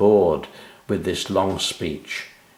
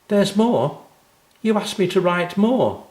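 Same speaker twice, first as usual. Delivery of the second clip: put-on high, insistent child's voice; eager little girl; 11 semitones higher than usual. A narrator's reading. Anna interrupts the clapping.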